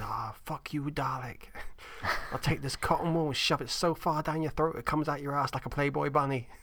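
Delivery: put-on Scottish accent for a sweary rant; comic Scottish-accented insult. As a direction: imitate a rude Scotsman. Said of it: "That's my Scottish accent".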